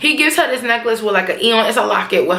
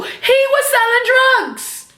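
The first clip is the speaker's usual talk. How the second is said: mock Tasha; 12 semitones higher, much slower than usual. Voicing Tasha telling Ghost.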